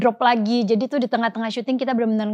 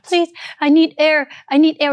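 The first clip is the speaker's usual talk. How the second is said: put-on pleading child; 5 semitones higher, 4 dB louder, slower than usual. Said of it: "gue bilang".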